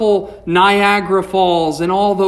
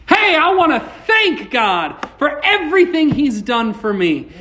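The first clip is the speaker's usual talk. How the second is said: higher, eager burst of thanks; excited thanks; 6 semitones higher than usual. Shown as a demonstration. Preacher showing how to thank God, excited. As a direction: throw some joy into it. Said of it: "it's okay to get a little bit excited".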